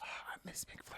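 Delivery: faint whispered longing; whispered sigh of missing a treat. Real, not acted. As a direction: barely voice it, wistful. Mourning McFlurries in whisper.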